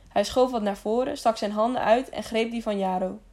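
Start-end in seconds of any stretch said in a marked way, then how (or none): none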